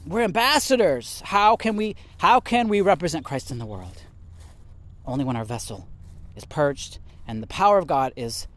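ordinary talk, nothing marked

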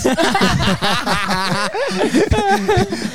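long, high peal of laughter; hearty laugh. Real, not acted. Laughing at friend's phobia of scissors, long high laugh. Joking about cutting his dreadlocks.

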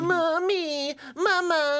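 put-on high, whimpering baby voice; whiny baby voice. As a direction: cry for mama like a toddler. Mocking himself as a sad baby.

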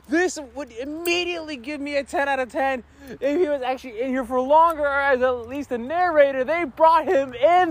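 high-pitched, gushing fanboy; fanboy excitement. Real, not acted. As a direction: gush like a thrilled fan. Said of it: "fanboy gasm".

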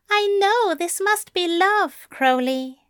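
high, teasing angel voice; mischievous grin. A narrator's reading. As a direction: deliver the corny line high, with a grin. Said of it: "grinned at him mischievously".

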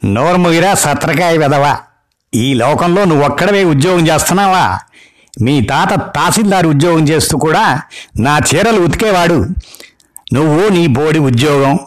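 put-on an old granny demanding attention; scolding grandma. Grandma on the phone orders the wife, scolding old-woman voice. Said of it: "బామ్మగారి గొంతు".

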